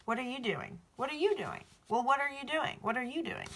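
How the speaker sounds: repeated high mocking questions; mocking boy. Reader mocks the boy's odd question, high and teasing. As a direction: parody the boy's clueless question over and over. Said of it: "the boy's like".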